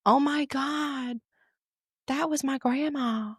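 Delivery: high, drawn-out mock gasp of recognition; amazed exclamation. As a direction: stretch it out high like an awestruck listener. Imagined listener recognises grandma.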